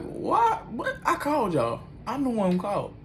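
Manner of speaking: high, stunned what; shocked disbelief. Retold or imitated. Told he is going to jail though he called, shocked high outcry. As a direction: spike the what in outrage.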